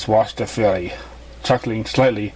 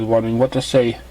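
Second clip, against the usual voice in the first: usual speaking voice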